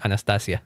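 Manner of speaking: Anastasia with open Spanish a's and a clear s; Spanish-style Anastasia; Spanish pronunciation. Shown as a demonstration. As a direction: Anastasia the Spanish way, a-na-sta-sia. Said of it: "that's how you would say it in Spanish".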